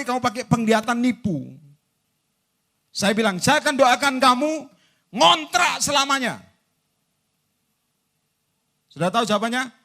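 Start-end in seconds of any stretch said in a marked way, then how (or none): 3.4-6.4 mock threatening prayer. Preacher praying a member rents forever, loud mock threat. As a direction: proclaim a mock curse at full voice.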